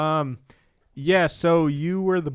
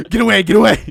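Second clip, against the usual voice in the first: mock frightened cry; 5 semitones higher, 14 dB louder, faster than usual. Acting scared villain.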